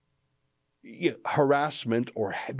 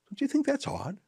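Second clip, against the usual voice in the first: incredulous; 12 semitones higher, 4 dB quieter than usual. Real, not acted. Pointed rhetorical question to viewers.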